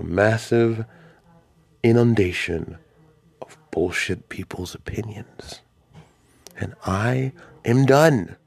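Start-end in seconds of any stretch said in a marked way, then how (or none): 3.4-5.6 close-mic whisper. Real, not acted. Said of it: "whisper into your ear". 6.6-8.4 fed up. Real, not acted. Fed up, declaring he's done.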